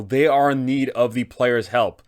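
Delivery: normal talking voice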